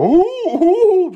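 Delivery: high excited ooh; hammed-up crowd ooh; mock gamblers' ooh. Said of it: "everybody ... gonna go".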